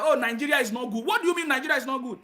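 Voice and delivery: ordinary delivery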